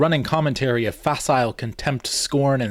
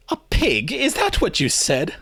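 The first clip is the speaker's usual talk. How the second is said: laughing mockery; 7 semitones higher than usual. A narrator's reading. Simon mocks Lewis's guess.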